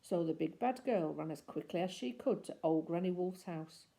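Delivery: ordinary delivery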